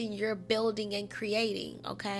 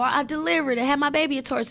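excited realization; 5 semitones higher, 8 dB louder, faster than usual. Retold or imitated. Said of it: "You think about, like".

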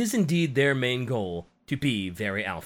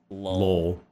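ordinary delivery

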